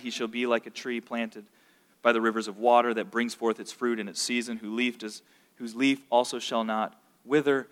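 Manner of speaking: measured, reverent reading of the psalm; scripture reading. Acted. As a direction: read it like holy text before the class. Reads Psalm one aloud.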